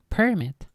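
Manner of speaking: Permit, demonstrated carefully as the right way; proper pronunciation. Shown as a demonstration. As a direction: say Permit the proper way with clear emphasis. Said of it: "the proper way".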